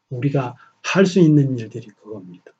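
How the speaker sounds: normal talking voice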